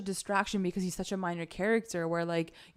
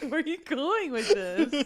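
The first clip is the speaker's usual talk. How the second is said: laughing disbelief; 7 semitones higher, slower than usual. Retold or imitated. Laughing reaction to plot.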